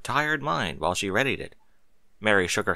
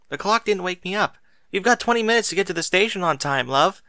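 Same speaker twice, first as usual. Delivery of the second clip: lightly voiced harried wife; 9 semitones higher than usual. Mary scolding husband sweetly.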